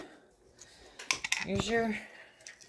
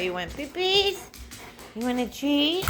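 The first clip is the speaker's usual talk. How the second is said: talking to a dog; 6 semitones higher than usual. Real, not acted. Baby talk to dog.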